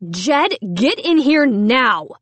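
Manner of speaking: slow, stern summons; angry call. A narrator's reading. Mom angrily summoning her son, slow stern call. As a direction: call him in, each word weighted. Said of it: "Mom shouted angrily".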